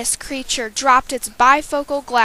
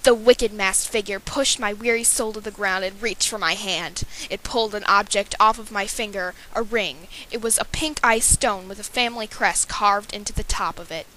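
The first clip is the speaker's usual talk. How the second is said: usual speaking voice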